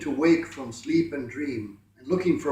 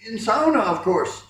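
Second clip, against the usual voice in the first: cheerful; 5 semitones higher, 7 dB louder than usual. A narrator's reading. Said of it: "said the cheerful Finn".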